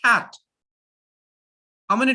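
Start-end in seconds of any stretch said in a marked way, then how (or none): 0.0-0.3 Cat said once, crisp and louder as a single beat; clear demo word. Shown as a demonstration. Teacher repeating cat for the chin test, firm demo. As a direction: clip Cat short in one syllable. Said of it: "How many times my chin hits my hand?".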